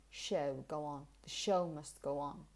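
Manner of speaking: low teacher demo weighting "show"; key words stressed. Shown as a demonstration. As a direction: isolate "Show", "go on", then the sentence. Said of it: "what you pronounce properly and really".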